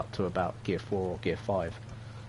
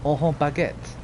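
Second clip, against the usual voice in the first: higher, louder mock French; mock French accent; 6 semitones higher, 5 dB louder, slower than usual. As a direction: put on a jokey French accent. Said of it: "Sounds French".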